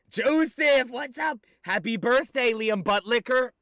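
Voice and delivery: high, loud hyped greeting; loud shout-out. Real, not acted. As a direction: call it out to the chat. Calling out greetings to chat.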